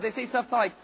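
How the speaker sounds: ordinary delivery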